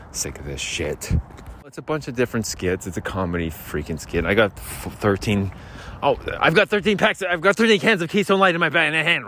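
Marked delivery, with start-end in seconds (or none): 0.1-1.1 softer, lower sighed mutter; tired muttered complaint. Real, not acted. Sighing aside. 6.0-9.3 higher, fast excited bragging; excited brag about beer. Acted. Excited claim, later called yelling.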